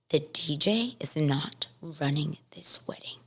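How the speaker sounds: hissed slow whisper; a slow, icy whisper of contempt; cold whisper. Retold or imitated. Planner's cold retort, retold.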